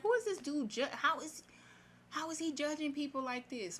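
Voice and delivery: high-pitched indignant questioning; retold outraged disbelief; incredulous objection. Acts own indignant reaction to preacher.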